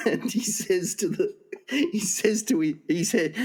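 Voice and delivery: high, halting, breaking into laughter; real giggling. Real, not acted. Joke teller cracking up before the punchline, laughing. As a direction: struggle to get the words out through giggles.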